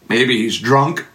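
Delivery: ordinary talk, nothing marked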